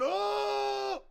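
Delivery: a high drawn-out mock oh; imitating a shock jock yell. Imitating radio guys, a high mock yell. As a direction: hold a long high oh like radio dudes. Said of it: "dudes going".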